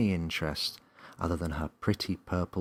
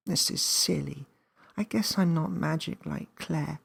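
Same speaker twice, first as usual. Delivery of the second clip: put-on higher little girl voice, disappointed; a small girl giving up sadly; Millie sulking; 10 semitones higher than usual. A narrator's reading.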